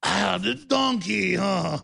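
drawn-out mock accent, part Puss in Boots; badly faked cartoon accent, slow and hammy; Shrek. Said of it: "We're like Puss in Boots meets the".

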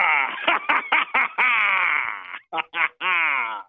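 long, hammed-up villain cackle; evil villain laugh. Caller posing as Gorka on air, evil villain laugh. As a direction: laugh like a gloating arch-villain. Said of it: "Sounds like an evil villain".

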